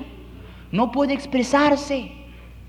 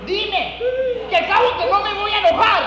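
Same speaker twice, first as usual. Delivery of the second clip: coaxing turns to an angry demand; father's patience cracking into a shout; impatient father; 10 semitones higher than usual. Acted. Acted father losing patience.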